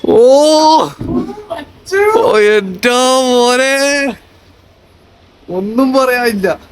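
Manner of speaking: drawn-out high awe; amazed. Real, not acted. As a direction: gasp it out in wonder. Awed at room view.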